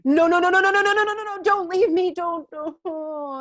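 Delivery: high, loud, frantic run of no; begging the feeling not to go; desperate pleading. Retold or imitated. The speaker losing the soul memory, frantic, high and loud. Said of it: "I'm like".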